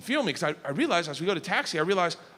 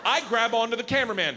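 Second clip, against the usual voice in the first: normal talking voice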